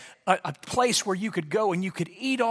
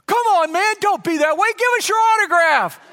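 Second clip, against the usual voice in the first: put-on high, loud insistent kid voices; pleading kids; 8 dB louder than usual. Kids pressing the pastor for autographs, high and loud.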